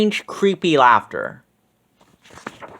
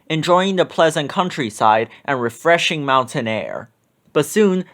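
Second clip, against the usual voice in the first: ordinary delivery, nothing marked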